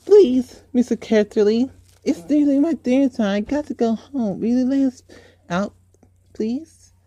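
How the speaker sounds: put-on pleading child. Girl begs the uncle to let her go home, high and pleading. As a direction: beg like a frightened child.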